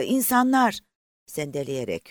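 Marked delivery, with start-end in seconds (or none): 0.0-0.8 "İnsanlar!" much higher and louder, falling; calling out in sudden joy; excited cry. A narrator's reading. Sees people, cries out again. (sevinçle haykırarak)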